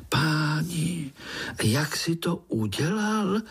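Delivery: high-pitched eager question; excited amazement. A narrator's reading. Pája asks uncle how he escaped, excited. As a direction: leap in high, bursting with awe. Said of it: "povyskočil Pája".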